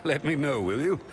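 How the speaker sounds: chuckling as you tease; laughing. Acted. Teasing a companion about trust, laughing. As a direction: laugh through the teasing question.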